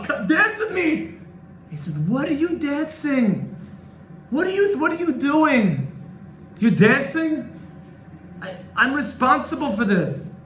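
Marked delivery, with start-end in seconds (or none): 0.0-1.2 high, slow, gleeful call; excited invitation. Retold or imitated. Rabbi calls his friend to dance, elated. 2.0-10.3 high, pleading disbelief; can't believe he's dancing; bewildered protest. Retold or imitated. Guilty friend protests the dancing, high and bewildered.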